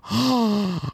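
sharp, high dramatic gasp; shocked gasp. Acted. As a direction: gasp as if scandalised. Mock shock at fan's reaction.

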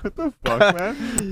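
high-pitched mock bafflement; retold bafflement. Retold baffled reaction to the guy.

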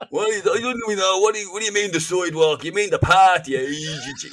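high, loud, indignant stage Irish; exaggerated Irish accent. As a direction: ham up the Irish accent in outrage. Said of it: "suddenly sound ten times more Irish".

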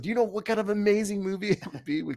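giddy fan excitement, voice shooting up; excited gush. Real, not acted. Gushing about a dream casting.

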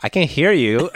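high, loud call to intruders; hammed-up loud protest; mock calling out. Acted creature calling to intruders.

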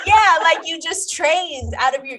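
high, loud and slow teasing; drawn-out mock scolding; exasperated. Teasing retort to gym partner.

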